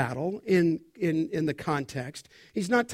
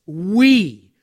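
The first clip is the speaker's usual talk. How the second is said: "We" loud and drawn out alone; emphatic WE; 8 dB louder than usual. Real, not acted. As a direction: punch "We" and hold it. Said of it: "emphatic personal pronouns".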